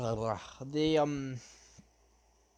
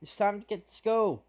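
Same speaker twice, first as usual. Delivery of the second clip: raised, higher wake-up call; yelling out; 5 semitones higher than usual. Acted. Izuku yells out to everybody before school starts, a raised shout. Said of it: "So he yells out to everybody".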